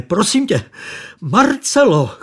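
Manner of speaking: the fat man begging Marcela to stay away, crying out; pleading cry. A narrator's reading. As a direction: plead with her at the top of your voice. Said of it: "křičel úpěnlivě".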